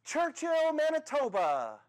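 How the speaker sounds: loud, high announcer shout, falling at the end; big drawn-out prize proclamation; triumphant reveal. Acted. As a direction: proclaim the destination, stretching it out. Manager reveals the prize loudly.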